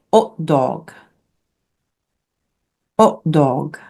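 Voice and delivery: French "hot dog" without the H, ut-dog; silent H. Shown as a demonstration. Teacher demonstrates hot dog in French, silent H. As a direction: slowly drop the H in "hot dog". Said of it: "We don't make that sound, that H sound".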